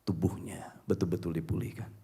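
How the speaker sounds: hushed reverent narration; awed whisper. A narrator's reading. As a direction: whisper the miracle. Miracle moment of the story.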